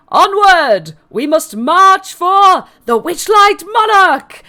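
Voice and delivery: high, slow proclaiming shout; rally the whole crowd to march; rallying cry. Acted. Mister Witch leading the procession out, rallying shout.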